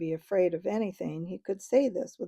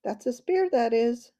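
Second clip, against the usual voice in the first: higher, louder, smug Dufflepud chief; proud chief voice; 5 semitones higher, 6 dB louder than usual. A narrator's reading. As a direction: show off the spear, pleased. Chief proudly shows the spear.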